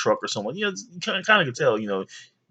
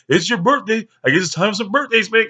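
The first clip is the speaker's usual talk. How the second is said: higher, louder mock-threatening mom; 10 semitones higher, 5 dB louder than usual. Mom threatening birthday spankings, higher teasing threat. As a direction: promise birthday spankings, pitched up. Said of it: "She was".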